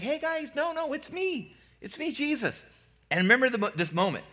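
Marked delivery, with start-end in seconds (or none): 0.0-2.6 kindly, soft high reassurance; gently calm frightened friends in a light, high voice; Jesus. Retold or imitated. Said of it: "Jesus very kindly is like".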